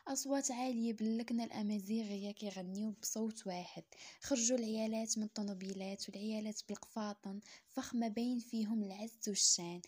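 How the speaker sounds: ordinary delivery, nothing marked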